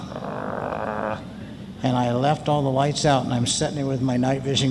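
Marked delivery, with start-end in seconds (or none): 0.0-1.2 rolled growl like the dog; imitated dog growl. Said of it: "And he's going".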